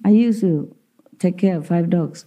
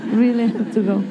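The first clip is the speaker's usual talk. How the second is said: lightly raised voice, a hint of a sad dog asking; voicing a pleading dog; 5 semitones higher than usual. Dog asking its owner if it must go in, mildly high pleading voice. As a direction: play the dog begging not to go, half laughing.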